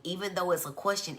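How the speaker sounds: ordinary talk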